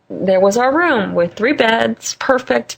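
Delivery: ordinary talk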